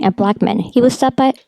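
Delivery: ordinary delivery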